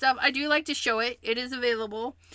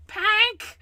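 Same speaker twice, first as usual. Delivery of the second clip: excited echo; 11 semitones higher, 6 dB louder than usual. Real, not acted. Echoing favorite colour pink.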